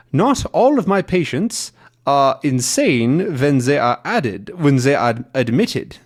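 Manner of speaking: hammed-up German villain doctor; German accent. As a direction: read it out in mock German accent. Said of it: "that's my German accent again".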